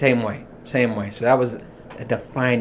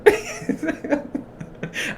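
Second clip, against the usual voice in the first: a breathless, high-pitched wheeze of laughter; high wheezy laugh; 5 dB quieter than usual. Real, not acted. Laughing at his own story.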